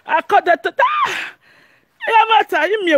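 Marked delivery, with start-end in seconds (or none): 0.8-1.3 short, very high-pitched cry; high shriek. Real, not acted. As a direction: let out a sudden high shriek. Sudden outburst while teasing opponent.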